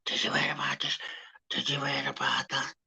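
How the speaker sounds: mock hushed gossip, all whispered; gossipy whisper. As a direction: whisper it like a busybody spreading drama. Mimicking gossips passing on drama.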